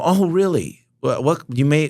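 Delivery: a lightly hammed-up oh really; mock surprise. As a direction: act politely startled by the news. Reacting to losing the customer.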